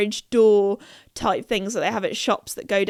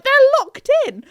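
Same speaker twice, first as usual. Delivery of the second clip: very high, loud cry; panicked announcement; 13 semitones higher, 7 dB louder than usual. Retold or imitated. Telling a friend about the others, panicked. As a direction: blurt it out in alarm.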